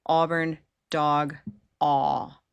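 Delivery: "Auburn dog" pelan lalu "aw" panjang; held aw. Shown as a demonstration. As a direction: tahan bunyi "aw" dengan rahang turun. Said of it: "I'll put Auburn dog over here".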